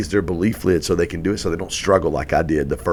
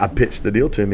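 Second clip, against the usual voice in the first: normal talking voice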